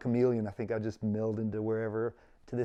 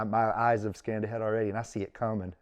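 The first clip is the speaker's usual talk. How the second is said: normal talking voice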